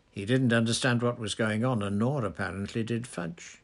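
plain reading voice

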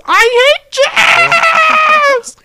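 mock screech, very high and loud; little Hitler. Sketch kid transformed after smoking weed, hammed-up shriek. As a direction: scream it with the last word stretched long. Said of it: "his eyes turn to swastikas".

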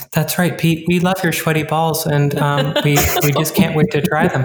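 lightly voiced calm radio host; NPR host voice. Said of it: "[name]'s using her, like".

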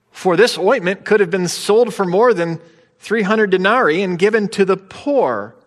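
self-righteous. Retold or imitated. Disciples' objection, read heightened.

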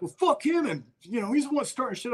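high-pitched, defensive complaint; retold indignant protest. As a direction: imitate a hothead blaming someone else.

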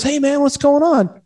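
imitating a friendly hail, pitched high; retold friendly hail. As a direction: call out a high, casual hello. Retold friendly greeting from star.

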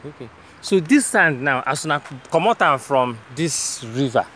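casual Pidgin question; Nigerian Pidgin. Real, not acted. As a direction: ask it in Naija Pidgin. Switches to pidgin with worker.

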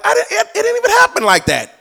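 high protest. Retold or imitated. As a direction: play the wronged one in a high voice. Falsely blamed believer protesting.

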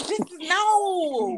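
very slow, high, crying whine; hammed-up dismayed wail; mock despair. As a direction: drag it out like mock grief. Horrified at the old picture.

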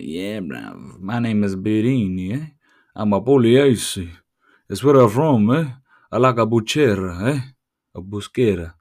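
hammed-up Italian accent, punchy and loud; mock Italian accent. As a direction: fake being Italian with a thick accent. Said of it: "dupe someone that you're Italian".

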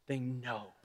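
very soft, low, near-whispered preaching; low, reverent murmur with long pauses; hushed awe. Retold or imitated. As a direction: let each phrase fall into a hush. Hushed awe, voicing Jesus.

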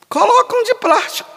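high, scornful echo of his answer; mocking echo. Priest scoffing at a man's plan, high mocking echo.

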